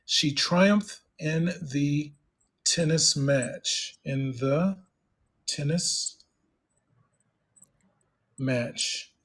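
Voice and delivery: very slow, word by word; slow dictation. Shown as a demonstration. Teacher writing a sentence for the student, slow dictation. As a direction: spell it out slowly as you type.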